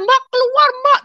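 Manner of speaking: very high, loud urgent call; urgent shout. Retold or imitated. Younger brother pulling sister away from beating, urgent shout. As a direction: act out a desperate shout to get out. Brother urges her to get out.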